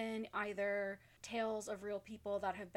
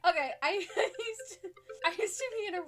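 laughing; 10 semitones higher, 7 dB louder, much faster than usual. Real, not acted. Recovering from laughter.

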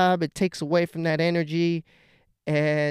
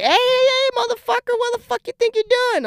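high, loud alarmed yell; fiancée yelling; 4 dB louder than usual. Retold or imitated. Fiancée stopping him from grabbing the toothbrush, loud high yell. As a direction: shout him to a stop in a high voice. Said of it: "my fiance's like".

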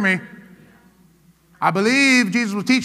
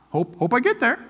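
quiet, unsure mock hope; faithless flyer; 4 dB quieter than usual. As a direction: imitate a timid traveler mumbling hope. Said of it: "do you just get on the airplane".

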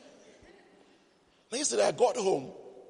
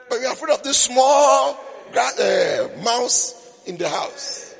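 mock outrage; 7 semitones higher, 9 dB louder than usual. Teasing women's fear, voice rising.

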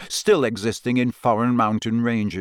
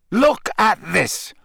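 snarled; 9 semitones higher, slower than usual. Acted. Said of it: "Harry snarled".

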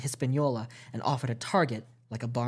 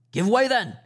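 higher, louder shouted command; cried order; 9 semitones higher, 7 dB louder than usual. A narrator's reading. Captain ordering the men to row hard, shouted. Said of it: "cried the captain".

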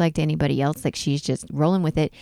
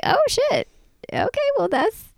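high, startled squeak of mock shock; a hammed-up high gasp of offense. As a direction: play the shocked wife, pitched way up. Mock shocked reaction to hidden account.

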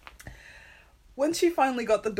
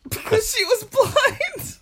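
mocking; 9 dB louder, slower than usual. Mocking punchline.

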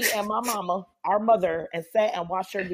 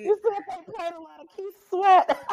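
teasing high; 11 semitones higher than usual. Real, not acted. Teasing with laugh.

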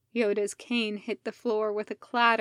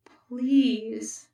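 plain reading voice

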